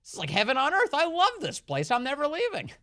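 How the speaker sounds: high, gushing delight; hammed-up ecstatic newcomer; mock gushing migrant. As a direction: rave like you found paradise. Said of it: "They're like".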